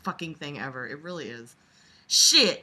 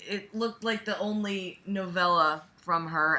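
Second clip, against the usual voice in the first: normal talking voice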